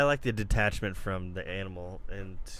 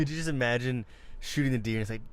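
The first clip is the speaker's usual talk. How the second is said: usual speaking voice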